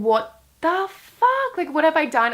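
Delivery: high, loud, exaggerated disbelief; stretched-out opening, high incredulous retelling; retold bewilderment. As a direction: replay your own baffled outrage. Retelling her baffled reaction to stranger.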